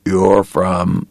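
weak, raw-throated croak; hoarse, effortful croak. A narrator's reading. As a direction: force the words out through a sore throat. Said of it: "and croak".

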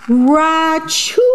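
high, sudden mock sneeze; big sneeze. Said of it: "makes the bear".

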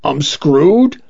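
starting to cry. A narrator's reading. Client fearing system collapse, lightly tearful. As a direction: ask it with a touch of tears. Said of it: "I started to cry".

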